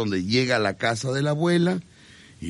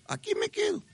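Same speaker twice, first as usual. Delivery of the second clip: squeaky grandma; 7 dB quieter than usual. Acted. Grandma insisting in story.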